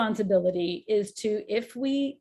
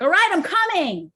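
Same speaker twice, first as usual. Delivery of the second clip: much higher and louder, exasperated "All right, I'm coming"; annoyed reply; 9 semitones higher, 7 dB louder than usual. Shown as a demonstration. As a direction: shout back irritated at being called. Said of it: "it's like".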